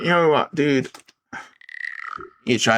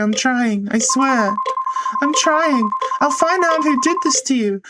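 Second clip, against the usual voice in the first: a much higher, pleading voice; desperate pleading character. Acted. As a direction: beg as if about to cry. Character begs the ghost.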